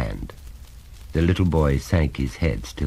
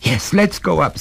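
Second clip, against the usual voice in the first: high, urgent voice; anxious, pitched-up reply; alarmed agreement; 13 semitones higher, 3 dB louder than usual. Acted. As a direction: agree in rising panic. Mother alarmed at the sound.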